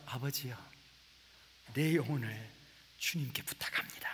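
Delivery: soft, low, fading whisper of a dying man; hushed last breath prayer. Acted. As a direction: breathe the last words out gently. Dying words of Jesus quoted.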